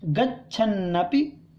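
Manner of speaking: normal talking voice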